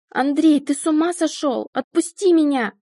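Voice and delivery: high, loud panicked shriek. Acted. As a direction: scream it out in terror. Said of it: "визжала она".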